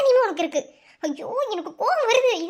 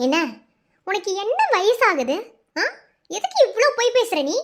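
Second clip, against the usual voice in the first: ordinary delivery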